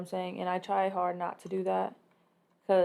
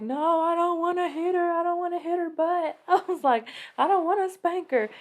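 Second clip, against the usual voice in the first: much higher, pleading whine; whiny pleading; 10 semitones higher, 4 dB louder than usual. Retold or imitated. Wife pleading with husband not to spank daughter, higher whine. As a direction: beg not to have to do it. Voicing her reluctant self.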